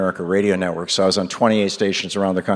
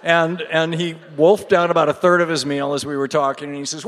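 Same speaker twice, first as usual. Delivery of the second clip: ordinary talk, nothing marked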